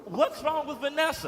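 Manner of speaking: high, loud defensive squeal; indignant protest. Acted. Man defending his date to his brother, loud high indignant voice. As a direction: protest at the top of your range.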